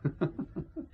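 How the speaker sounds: breathy chuckle under the breath; a quiet laugh at his wit; soft amused laugh. Acted. Host laughing softly at his guest's joke, amused.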